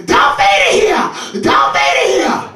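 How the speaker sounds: put-on high, loud, slow shrieking children's voices; screaming kids. Children fleeing the house at Darth Vader, shrieking high and loud.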